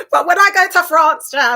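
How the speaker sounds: loud, high words through laughter; laughing. Real, not acted. Host still laughing as she tells her France story. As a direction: keep laughing as you talk.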